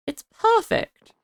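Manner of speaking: It's perfect sung out high and slow; drawn-out high delight. Real, not acted. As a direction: savour It's perfect, stretched and rising. Repeated with different tune.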